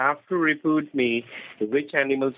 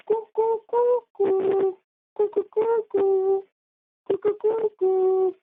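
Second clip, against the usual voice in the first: very high imitation cooing; playful bird sound for a memory game; pigeon coo; slower than usual. Shown as a demonstration. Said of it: "Now I'm going to sound".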